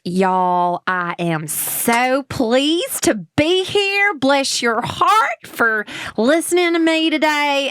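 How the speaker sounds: high, slow Alabama drawl; effusive, stretched-out Southern twang; Southern US accent. Shown as a demonstration. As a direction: gush like a Georgia belle in a Southern accent. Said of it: "Alabama, Georgia, like, like, Southern".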